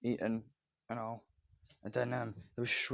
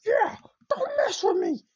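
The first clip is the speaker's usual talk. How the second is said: very high, loud, slow squeal; hammed-up little creature acting hard; tough shrimp; 7 dB louder than usual. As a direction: squeak a threat as a tiny tough guy. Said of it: "became a tough shrimp".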